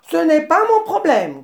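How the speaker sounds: réplique sèche, plus haute et plus forte; rembarre-le avec mépris; grognement du babouin. A narrator's reading. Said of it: "grogna le babouin". (grogne au nez du phacochère)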